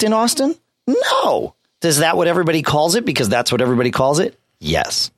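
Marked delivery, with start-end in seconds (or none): none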